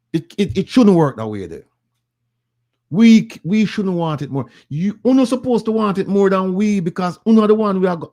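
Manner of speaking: high, loud, rising passion; impassioned plea. Real, not acted. Speaker urging Jamaican people, high impassioned plea.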